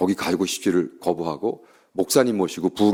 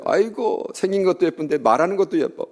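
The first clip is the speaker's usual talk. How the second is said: gushing; 8 semitones higher than usual. Retold or imitated. Pastor gushing over sweet student.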